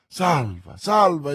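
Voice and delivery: relief bursting out; exclaiming. A narrator's reading. Milady, just revived in the boat, to Felton: relieved joyful exclamation. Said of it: "esclamò".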